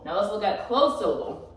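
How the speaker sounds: ordinary talk, nothing marked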